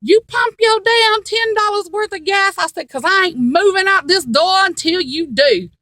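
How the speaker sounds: retold holler at her husband. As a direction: yell it to someone far off. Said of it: "I hollered across the parking lot".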